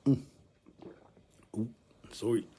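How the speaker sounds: normal talking voice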